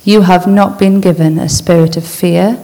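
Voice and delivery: slow, measured reading with long pauses between lines; slow scripture reading. Acted. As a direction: read each truth slowly so it sinks in. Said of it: "I'll just read these out slowly".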